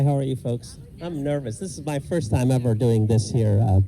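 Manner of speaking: ordinary talk, nothing marked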